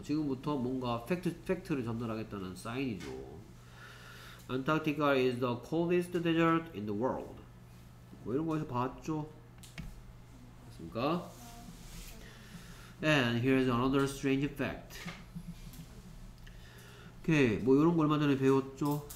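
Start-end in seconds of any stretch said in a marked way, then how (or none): 4.5-7.3 very slow, word by word; slow read-aloud. Shown as a demonstration. Teacher reading the rain sentence slowly to students. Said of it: "teacher reads sentence". 13.0-15.0 very slow, word by word; slow read-aloud. Shown as a demonstration. Teacher reading the rain sentence slowly to students. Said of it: "teacher reads sentence".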